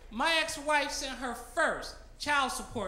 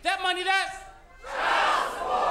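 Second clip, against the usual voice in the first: put-on louder, with child support drawn out slow; more enthusiasm; 4 semitones higher, 4 dB louder, slower than usual. Shown as a demonstration. Dad leading the crowd in a chant, loud and drawn out. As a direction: belt it out for the crowd to shout back. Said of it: "A little bit more enthusiasm".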